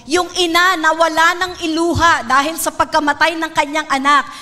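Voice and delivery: usual speaking voice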